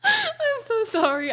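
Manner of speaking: high, slow, laugh-cry apology. Real, not acted. Cook apologizes to viewers in a laughing fit. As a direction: apologize through helpless laughter.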